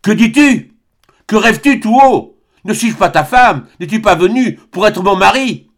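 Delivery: high, loud, shaking him with cries; crying out. Acted. Albine shaking Serge and crying out, high and loud. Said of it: "Elle le secoua en criant".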